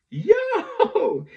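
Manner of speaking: very high, loud yo; joyful shout of recognition; excited greeting. Retold or imitated. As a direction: cheer him back.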